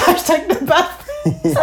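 slow, giggly repeat; laughing. Real, not acted. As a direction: say it while laughing.

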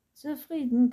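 high, loud, teasing "Zufrieden?"; grinning. A narrator's reading. Vampire shows Anton his new face, grinning. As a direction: say it with a broad grin. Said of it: "grinste der Vampir".

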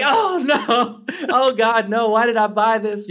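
high, laughing mock panic; hammed-up laughing dismay; mock despair, laughing. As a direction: groan through laughter at your own mistake. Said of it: "And I'm like".

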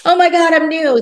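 high, loud imitation of an ecstatic convert; mock gushing amazement. Imagined guest after ayahuasca, mock-ecstatic high exclamation. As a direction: exclaim it like someone hammily reborn.

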